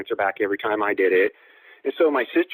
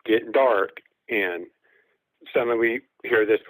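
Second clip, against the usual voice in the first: ordinary talk, nothing marked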